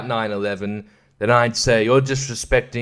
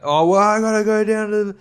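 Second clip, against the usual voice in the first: whining complainer, pitched higher; grumbling local; 7 semitones higher than usual. Retold or imitated. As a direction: gripe about having to vote, voice up high. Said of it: "angry about it".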